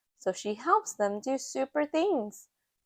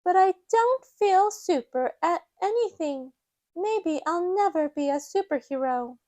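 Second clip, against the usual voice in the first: put-on high child voice, a touch downcast; a mild little worry; small girl doubting herself; worried Peppa; 7 semitones higher than usual. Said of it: "Peppa says".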